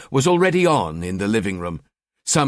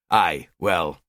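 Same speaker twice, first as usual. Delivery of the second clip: stalling; 5 semitones lower than usual. Acted. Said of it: "he stalled".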